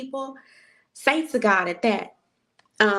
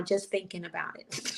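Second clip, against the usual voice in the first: whisper; 2 semitones lower, 10 dB quieter than usual. Real, not acted.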